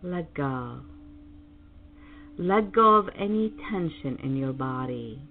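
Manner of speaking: slow, soothing meditation voice; calm meditative tone. Acted. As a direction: guide them gently into calm. Leads a guided meditation.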